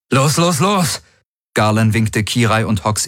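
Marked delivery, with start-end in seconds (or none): 0.1-1.0 raised, driving go-go-go; urgent hurrying. A narrator's reading. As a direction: drive the crew on, voice pitched up. Hurrying crew to the ship. (antreibendes, erregtes Rufen)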